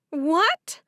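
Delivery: sharp high cry of shock; shocked shriek. A narrator's reading. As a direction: cry out in disbelief. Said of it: "Favor shrieked".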